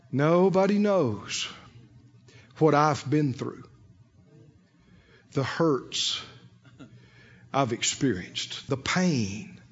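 very low, soft, halting lament; milk the hurt for attention, slowly; mock self-pity. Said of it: "It's drawing attention to yourself".

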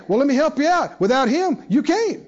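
usual speaking voice

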